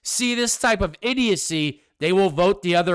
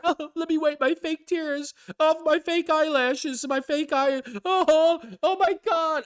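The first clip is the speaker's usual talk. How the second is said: higher, hammed-up teary pundit voice; mock the fake tears, pitched way up; mock fake crying; 9 semitones higher than usual. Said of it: "everybody's pretend they have to fake cry".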